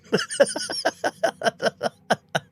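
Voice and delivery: high wheezing snicker of a laugh; wheezy Muttley laugh. Real, not acted. Streamer laughing at his own wheeze, like Muttley. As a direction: laugh through a tight, wheezy chest. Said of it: "I literally sound like Muttley when I laugh".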